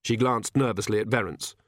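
ordinary delivery, nothing marked

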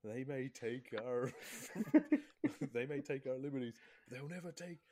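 rally the troops like a movie hero, half laughing; mock Braveheart speech. Mates joking about Braveheart, mock rousing battle speech.

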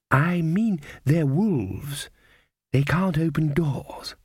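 put-on a gentle old woman explaining patiently; kindly old grandmother. Grandmother keeps talking.

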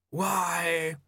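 lightly voiced, puzzled Famine. A narrator's reading. Famine asks Death.

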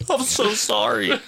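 high laughing mock apology. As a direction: say sorry through a high laugh. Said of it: "I'm like, laughing".

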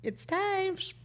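high, soft coaxing with shushes; bedtime shushing. Retold or imitated. Aunt putting child to sleep, cooing. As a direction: coo it high, hushing a baby to sleep. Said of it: "used to put Julian to sleep".

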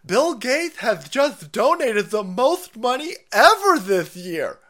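high, slow, loud gushing mock voice; sarcastic fake awe; mock gushing fan. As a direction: ham up a breathless fan going on about Bill Gates. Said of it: "It's like".

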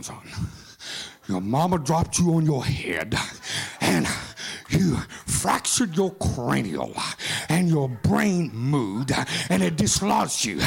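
preacher lampooning a condescending critic; mock know-it-all critic. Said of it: "Some people wanna come in and say".

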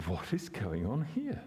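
usual speaking voice

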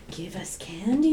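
drawn-out, whispery creepy-twin plea; slow, hushed spooky child act. Pretend. Friends begging as the Shining twins, whispered creepily. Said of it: "we're the twins, um, from The Shining".

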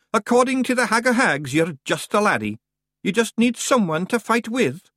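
put-on a small leader sizing up a boy; Feegle voice. A narrator's reading. Rob Anybody's voice.

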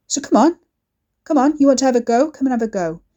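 goading, loud and fast; taunting shout. A narrator's reading. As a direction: egg her on to fight. Ricky taunting her to fight.

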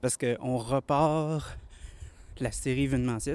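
out of breath. Real, not acted. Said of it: "je suis à bout de souffle".

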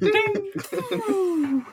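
high ringing "ding" held long; imitated can ding. Imitates spit hitting a can.

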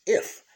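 If punched out loud on its own; stress on if. Shown as a demonstration. Preacher to his viewers, punching If loudly. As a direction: hit the word If hard and alone. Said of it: "The emphasis on if".

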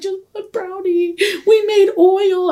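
high, tearful mock whine; imitated crying. Confessing to brother about the brownie, high mock-crying whine. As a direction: wail it like a guilty confession. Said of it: "And I started crying".

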